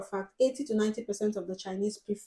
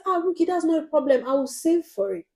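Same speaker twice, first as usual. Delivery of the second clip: higher, breezy brush-off; mock airy customer; 8 semitones higher, 4 dB louder than usual. Customer brushing off the cost, higher airy mock voice. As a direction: toss it off like it's nothing.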